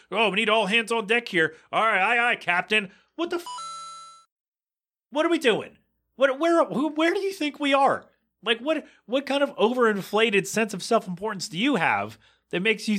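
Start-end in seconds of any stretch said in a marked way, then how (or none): 0.1-2.9 high, hammed-up sailor banter; mock pirate crew. 3.2-10.8 much higher, exasperated ranting; incredulous rant. Real, not acted. Ranting at buzzword managers.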